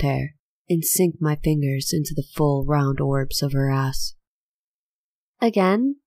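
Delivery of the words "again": smiling. A narrator's reading. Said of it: "I could hear the smile in her voice".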